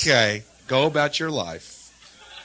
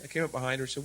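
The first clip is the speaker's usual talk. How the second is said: ordinary delivery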